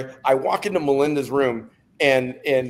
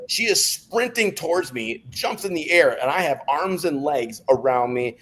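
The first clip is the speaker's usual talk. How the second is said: ordinary talk, nothing marked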